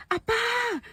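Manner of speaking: high panicked call for dad; scream for dad. Retold or imitated.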